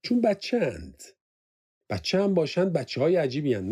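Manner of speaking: scornful mock reply. A narrator's reading. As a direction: sneer at the question. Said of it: "صدفان تمسخر زد".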